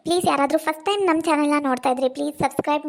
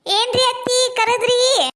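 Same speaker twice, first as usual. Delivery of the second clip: sugary slow reply; 6 semitones higher, much slower than usual. Acted. Daughter-in-law feigns sweetness.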